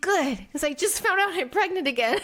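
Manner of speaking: high-pitched retold reply breaking into laughter; amused self-quote tipping into a laugh; laughing announcement. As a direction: blurt it out, then laugh. Retells pregnancy reply, breaks into laugh.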